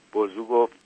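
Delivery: plain reading voice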